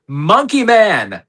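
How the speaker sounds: high, drawn-out title reveal; hyped announcement. Real, not acted. Host announces next week's movie, hyped announcement. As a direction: stretch it out like a big reveal.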